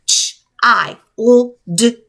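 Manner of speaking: teacher spelling aloud; spelled letter by letter. Shown as a demonstration. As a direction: spell out Ch-I-L-D slowly. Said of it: "Ch-, see that?".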